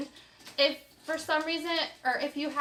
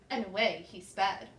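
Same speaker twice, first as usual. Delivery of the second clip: ordinary delivery, nothing marked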